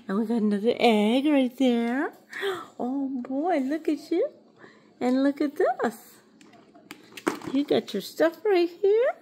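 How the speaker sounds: high, sing-song cooing to a pet. Real, not acted.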